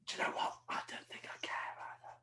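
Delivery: hushed whispered aside; whispering. Real, not acted. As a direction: drop to a whisper. Aside during podcast chat.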